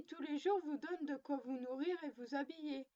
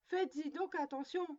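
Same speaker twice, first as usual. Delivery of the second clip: ordinary delivery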